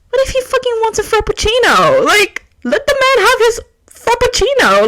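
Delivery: high, fast, worked-up; excited. Real, not acted. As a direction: rant it with mock outrage. Mock defending bros, excited.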